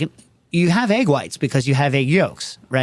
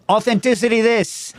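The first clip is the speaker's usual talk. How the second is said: imitating my family; 8 semitones higher than usual. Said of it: "that's how my family does talk".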